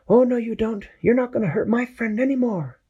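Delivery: high determined sidekick; Peso's voice. Acted. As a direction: stand up to the germ bravely. Peso defends friend.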